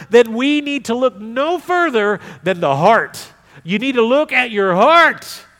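loud, high, driving sermon delivery; full-force preaching. Real, not acted. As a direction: preach it hard to the congregation.

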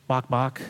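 two plainly spoken clucks; understated, deadpan chicken clucking. Acted. Chicken praising the farmer, mild clucking. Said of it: "And the chicken says".

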